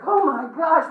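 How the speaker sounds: high, loud mock gasp of shock; mock shocked exclaim. Colleagues sending links, shrieking in mock shock. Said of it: "everybody in the death field was like".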